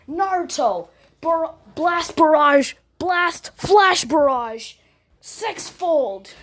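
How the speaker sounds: loud, slow battle cry; yelling attack name. Retold or imitated. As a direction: shout the move name. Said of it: "he yells".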